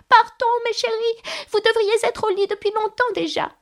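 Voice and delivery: very high, quavering canary; trembling voice. A narrator's reading. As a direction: chirp anxiously to the children in a tremulous squeak. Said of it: "d'une voix tremblante". (voix tremblante et suraiguë)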